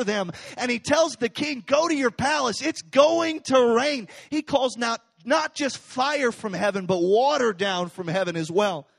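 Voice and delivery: fiery sermon storytelling; preaching at full force. Acted. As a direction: drive the story home to the crowd.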